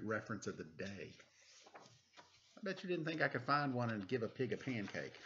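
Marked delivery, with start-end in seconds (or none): none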